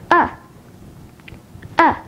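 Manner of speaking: very short schwa "A" as in about; quick unstressed "A", like the end of cinema; short vowel demo. Shown as a demonstration. Teacher showing learners the short vowels, "A" as a schwa. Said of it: "Take a look at the short vowels".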